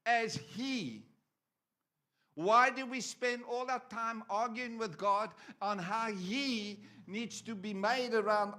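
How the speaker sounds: loud, driving preacher voice; fiery preaching. Acted. Preacher challenges the congregation on arguing with God, loud. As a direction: press the point hard on the church.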